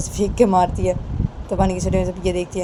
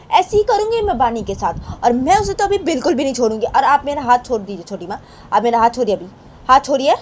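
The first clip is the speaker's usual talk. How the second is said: raised, higher-pitched protest; defiant daughter-in-law; 6 semitones higher, 5 dB louder than usual. Acted. Rama justifying her temper to Chhoti Maa, hot and defiant. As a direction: argue back hotly at your elder.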